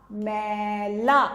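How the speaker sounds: मैला stretched slowly, ऐ held long; drawn-out मैला; slow demo word. Shown as a demonstration. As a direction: sound out मैला slowly for pupils. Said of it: "उसको हम कैसे बोलेंगे".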